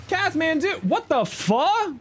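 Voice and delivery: high, stretched, stunned outburst; shocked. Real, not acted. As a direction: react like you can't believe it. Shocked by big gift.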